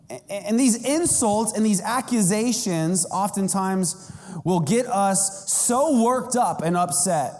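ordinary talk, nothing marked

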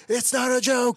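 very high, loud mock shriek; shouted protest. SpongeBob protests in recap.

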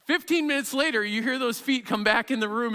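normal talking voice

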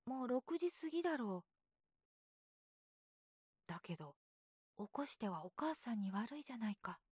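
a drowsy question from bed. A narrator's reading. Brother cautions.

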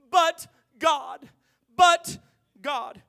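loud shouted but God; preaching shout. Acted. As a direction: hammer the two words home.

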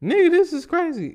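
high incredulous listener; mock disbelief. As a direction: exclaim it like a shocked viewer. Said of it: "it's like".

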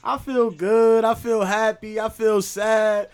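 put-on high, slow little-kid answers. Preschoolers answer their teacher one by one, high and slow. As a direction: drawl it out like a preschooler. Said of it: "Some of the kids like".

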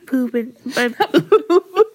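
breaking into laughter mid-word; laughing. Real, not acted. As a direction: collapse into giggles. Cracking up mid-sentence.

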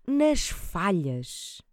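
ordinary talk, nothing marked

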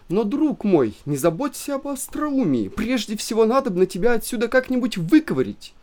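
high, pressing interruption; anxious interrupting. A narrator's reading. Wife interrupts her husband, high and anxious. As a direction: cut in anxiously. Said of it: "прервала Елена Ивановна".

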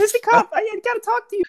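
high, soft put-on voice; fish wife. Wife asks husband for a word, high put-on voice. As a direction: plead high and softly.

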